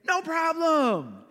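high, drawn-out fake reassurance; feigned cheer. Upset preacher reassures the slide operator, feigned cheer. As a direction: stretch it out high, pretending all is fine.

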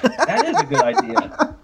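ordinary delivery

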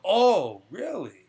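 acted-out surprise, high loud "oh" dropping away; surprised patient. Patient hears they are in control, acted-out surprise. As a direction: imitate a patient's pleasantly startled realization. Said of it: "as soon as that patient hears that".

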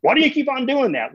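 mock yell at a disobedient child; exasperated yell. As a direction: snap at a kid who won't stop. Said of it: "If I yell at them".

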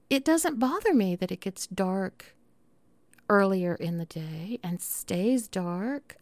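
ordinary talk, nothing marked

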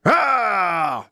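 short high roar, playing the Hulk; a kid's roar as he snaps a crayon; mock Hulk yell. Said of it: "I pretended to be The Incredible Hulk".

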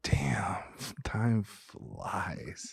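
breathy whispered aside; whispered to himself. Real, not acted. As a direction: say it under your breath, amazed. Quiet aside about time passing.

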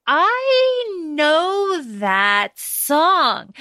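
drawn-out, very slow knowing line; slow recognition. Retold or imitated. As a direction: stretch each word as it dawns on him. Curtis recognizes the hummed song.

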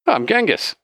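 Genghis. Acted. Bartender introduces himself to an orc, high character voice. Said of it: "he introduces himself as Genghis".

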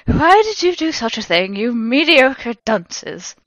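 loud, high indignant scolding; theatrical outraged demand, slowing at the end. A narrator's reading. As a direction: demand it haughtily, drawing out the insult. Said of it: "demanded Professor Snape".

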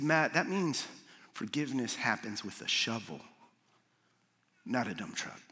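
a mentor calmly steadying a friend. Retold or imitated. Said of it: "And very calmly".

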